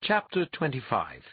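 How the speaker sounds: ordinary delivery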